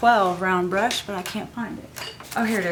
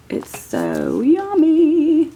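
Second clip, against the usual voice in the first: high, slow, louder gush; 8 semitones higher, 9 dB louder, much slower than usual. Real, not acted. Crafter gushing over the rose gold foil, high and drawn out. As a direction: savour the word like a treat.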